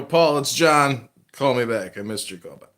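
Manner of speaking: normal talking voice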